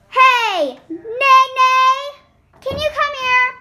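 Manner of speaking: calling out. Acted. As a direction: call her over loudly. Calling across the room.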